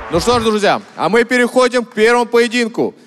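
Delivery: ordinary talk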